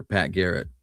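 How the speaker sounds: normal talking voice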